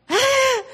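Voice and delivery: sharp, high gasp of surprise; surprised gasp. Retold or imitated. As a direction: catch your breath, caught off guard. Surprised at being recognized.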